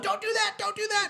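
high-pitched mock moviegoer yell; imitating yelling. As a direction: yell it like an imitated cinema heckler.

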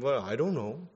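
mock innocent shrug, soft; a coy pretend I-don't-know; playing dumb. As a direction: feign ignorance, trailing off. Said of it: "pretending to play dumb".

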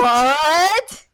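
loud, high rising cry; hammed-up echo of shock; mock shock. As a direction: stretch it upward in exaggerated disbelief. Co-host echoes the shocked cry.

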